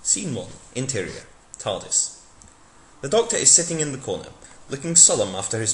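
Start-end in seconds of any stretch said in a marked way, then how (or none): none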